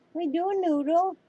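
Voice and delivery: talking to a dog. Real, not acted.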